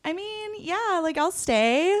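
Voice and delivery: high, coy little climb-down; mock-meek, high-voiced acceptance; sheepish giving in. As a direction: pitch it up, suddenly agreeable. Sudden meek change of mind.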